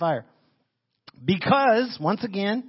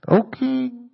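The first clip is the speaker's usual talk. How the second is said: muffled call, higher and louder; from behind the rock; 6 semitones higher, 7 dB louder than usual. Shown as a demonstration. Daniel answering the king from inside the den, muffled and far off. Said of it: "little special effects for you there. Behind the rock".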